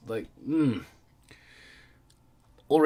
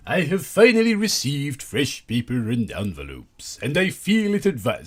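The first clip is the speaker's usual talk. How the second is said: louder, slower put-on voice reading the letter; mock Chris Chan reading voice, louder and slow; Chris Chan impression; 7 semitones higher, 10 dB louder than usual. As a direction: ham up the letter writer's pompous voice. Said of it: "sorry, I'm not gonna do that".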